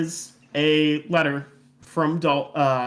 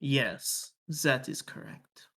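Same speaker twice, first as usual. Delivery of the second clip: put-on softer French-accented approval; 4 semitones lower, 6 dB quieter than usual. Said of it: "said in a French accent".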